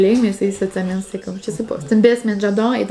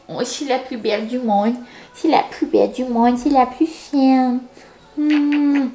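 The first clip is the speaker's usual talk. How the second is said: normal talking voice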